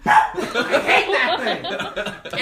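loud, slow, drawn-out grumble; annoyed outburst. Acted. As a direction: spit it out slowly in exasperation. Molly annoyed at carpet.

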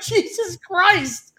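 high, drawn-out Jesus Christ; slow stunned exclamation; appalled at the answer; shocked disbelief. Retold or imitated. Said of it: "I'm like".

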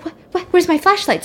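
high, stammering mock alarm; acted panic. Baby brother fumbling after the lights turn off, panicky.

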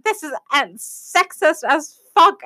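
high, drawn-out mock outrage; high-pitched, slow re-enacted protest; retold indignation. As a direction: act out an indignant comeback, stretching it slowly. Friend's indignant comeback in retold quarrel.

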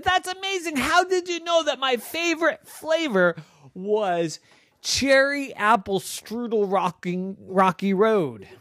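put-on very high, thrilled girl voice; delighted girl Noah. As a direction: gush like a kid getting a treat. Noah delighted with ice cream.

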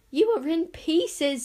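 high, slow and delighted; joyful. A narrator's reading. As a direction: savour the amazing news. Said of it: "said Zar joyfully".

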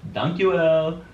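put-on Dutch-accented and punched up. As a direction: imitate a Dutch phrase with a lifted lilt. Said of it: "sounds Dutch, I guess".

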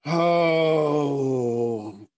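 long sighed oh, then resigned grumble; exasperated mom. Acted. Mom over her fainted son, exasperated and resigned. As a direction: sigh it out, fed up with the boy.

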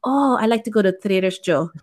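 imitated Filipino mom accent; mom's accent. Mom answering where she shops, in an imitated Filipino accent. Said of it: "but it does come out".

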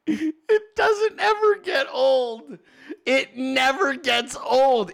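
high, slow, breaking into tearful laughter; laughing till crying. Real, not acted. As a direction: squeeze the words out through helpless laughter. Laughing to tears at clip.

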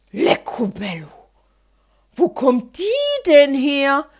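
loud, slow startled outcry; child crying out in fright at a mouse. Acted. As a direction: jump back in fright and cry out. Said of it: "rief ich vor Schreck".

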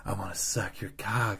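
a hint of sexy come-on, low and soft. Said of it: "sexiest voice in the world".

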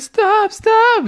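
very high, mock whimpering plea; mock pleading. Coworker after being punched, high mock whimpering plea. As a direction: imitate a beaten guy begging. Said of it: "he was like".

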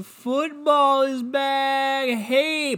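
slow, high, drawn-out cheer; hammed-up football hype; mock excited. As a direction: stretch it out like a hype announcer.

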